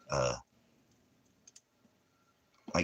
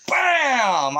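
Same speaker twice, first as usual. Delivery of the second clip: high, loud burst; sudden high shout; loud exclamation; 7 dB louder than usual. Real, not acted. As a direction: slam the word out.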